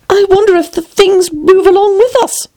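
high-pitched, wondering Alice; puzzled. A narrator's reading. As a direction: muse in a puzzled, girlish voice. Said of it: "thought poor puzzled Alice".

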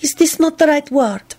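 coy. A narrator's reading. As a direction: ask it with playful innocence. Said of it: "asked him coyly".

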